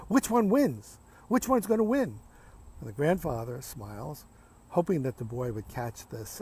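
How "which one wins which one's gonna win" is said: put-on higher-pitched child's urgent question; eager little boy. Little boy asking Granddad about two wolves, eager questioning. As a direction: ask it like a curious kid.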